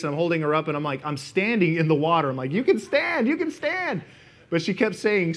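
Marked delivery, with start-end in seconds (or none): 2.5-4.0 high-pitched, insistent pleading; mock urgent insistence. Husband holding up his panicking wife, high urgent insistence. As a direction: call it out to someone panicking, voice pitched way up.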